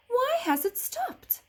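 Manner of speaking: put-on high, slow child wondering; puzzled girl. A narrator's reading. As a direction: ask it as a curious small girl.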